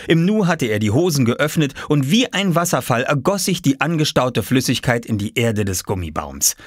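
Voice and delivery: ordinary delivery, nothing marked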